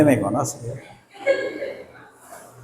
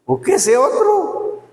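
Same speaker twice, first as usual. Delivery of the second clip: high drawn-out punchline; 10 semitones higher, slower than usual. Shown as a demonstration. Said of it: "punchline to the congregation".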